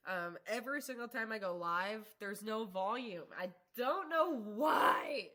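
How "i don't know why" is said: slow, louder, tearful complaint; real tears over a recurring tech problem; on the verge of tears. Real, not acted. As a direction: voice cracking with frustration. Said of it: "I'm gonna cry again".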